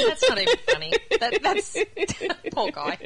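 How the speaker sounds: high-pitched, helpless laughing objection; laughing protest. Real, not acted. Co-host reacts to the punchline, laughing protest. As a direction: protest the joke while cracking up.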